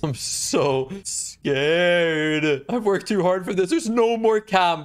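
ordinary delivery

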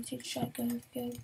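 usual speaking voice

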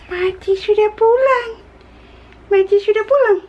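put-on high sing-song baby talk. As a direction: coo it high at a toddler. Mom baby talk to toddler.

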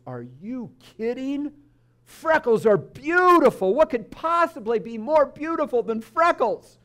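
high-pitched, rising into loud delight; gushing grandma. Acted. Grandma looks at her freckled grandson and reassures him, gushing. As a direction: gush with adoring disbelief.

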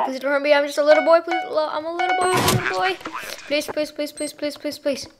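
high mock little boy whine; cowering plea. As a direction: beg like a scared little kid. Mock begging to enemy.

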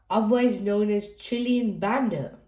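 usual speaking voice

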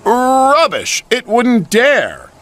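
scornful dismissal. Acted. James boasting defiantly.

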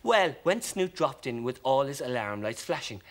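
usual speaking voice

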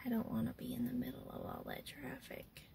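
whispered aside about traffic; a confiding hush; whispering. Real, not acted.

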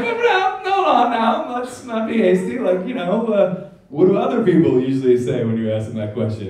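begging the doctor not to conclude he drinks, high; frantic backpedal. Retold or imitated. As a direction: scramble to talk the doctor down. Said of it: "And I was like".

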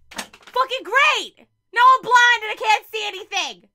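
loud, high, sarcastic outburst; frustrated yell. Real, not acted. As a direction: explode at the glare on the glasses. Glasses glare, frustrated outburst.

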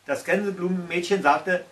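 ordinary delivery, nothing marked

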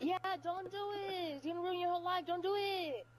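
ordinary talk, nothing marked

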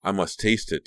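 usual speaking voice

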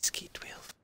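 barely audible unvoiced whisper. Real, not acted. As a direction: hush it so nobody hears. Whispering while listening for enemies.

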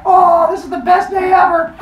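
high, loud squealing shout; overexcited fan. Acted. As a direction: gush like a thrilled crowd. Excited crowd in told story.

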